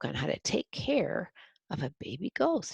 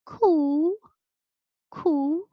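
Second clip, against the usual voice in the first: very high, louder cooing; baby coos; 12 semitones higher, 5 dB louder than usual. A narrator's reading. Baby ghost cooing at the nice lady, high cooing. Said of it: "startled to hear quite plainly".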